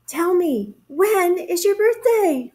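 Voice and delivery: high storybook moon voice; echoing moon. A narrator's reading. Moon repeats bear's question back, echoing voice. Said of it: "replied the moon".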